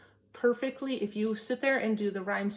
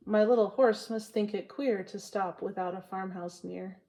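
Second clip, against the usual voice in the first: poem reading. Acted. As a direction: savour each line of the poem. Reads Frost poem to viewers.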